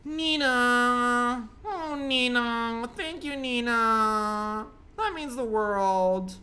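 overdone sarcastic thanks; mock gratitude. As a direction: ham up the thank-you to Nina. Mock thanks to a game character.

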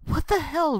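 can't believe the numbers; shocked outburst. Real, not acted. Shocked at alignment result.

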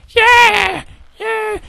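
put-on very high child cheer, fading; son's half-hearted cheer. Son cheering on Dad's demand, squeaky and fading. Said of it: "I wanna hear some excitement".

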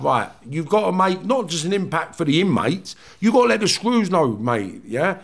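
mock-hard prison threat, slowed on key lines; retold defiant warning. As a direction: throw down the gauntlet, chest out. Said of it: "it was like".

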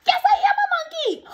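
very high, loud silly shriek; gleeful monkey. Acted. Reader forced to admit being a monkey, high silly screech.

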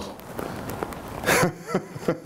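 breathy, high unvoiced cackle; mad laugh. Madman recalling hell, cackling softly.

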